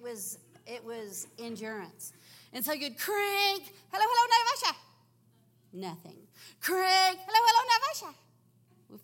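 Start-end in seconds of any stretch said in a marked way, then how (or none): 3.9-4.8 put-on loud, high shouting into an old phone; hammed-up shout into a crank phone; calling the operator. 7.2-9.0 put-on loud, high shouting into an old phone; hammed-up shout into a crank phone; calling the operator.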